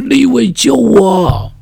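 high, loud, slow plea; boy crying out to his brother; desperate call. Pretend. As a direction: scream the name for rescue. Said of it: "一定在那里大声喊着说".

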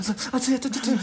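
high stammering gasps from numbing heat; mouth burning from spice. Retold or imitated. Ken eating the spicy beef noodles, high burning stammer. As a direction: stutter like your mouth is on fire. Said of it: "很烫".

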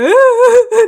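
loud, high imitated wail; mock crying. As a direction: imitate bawling in a burst of high noise. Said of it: "然后一面哭".

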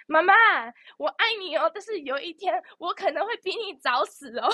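high, sing-song daughter to mom, half laughing; a light, teasing daughter voice; mock sweet confession. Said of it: "我不会这样子跟他讲".

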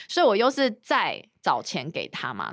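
usual speaking voice

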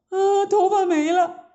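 high-pitched wail of dismay; shocked wail. A narrator's reading. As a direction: cry out on discovering a disaster. Maids see mirror, hair gone.

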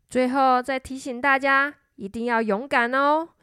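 ordinary delivery, nothing marked

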